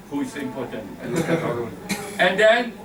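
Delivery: ordinary talk, nothing marked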